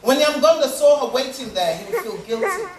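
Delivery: ordinary delivery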